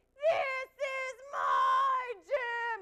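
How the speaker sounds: slow, high furious shout; yelling. Retold or imitated. Trainer yelling at her failing team, furious shout. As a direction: roar it, dragging every word. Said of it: "She's yelling".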